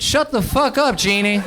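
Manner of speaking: high, loud mock angry yell; hammed-up angry shout. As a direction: bark it like the dad did. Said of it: "And then I wrote".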